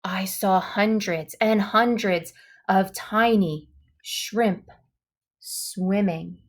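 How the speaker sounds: ordinary delivery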